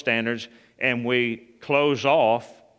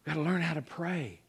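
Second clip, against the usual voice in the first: quiet; 4 semitones higher, 8 dB quieter than usual. Shown as a demonstration. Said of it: "So we gotta learn how to pray".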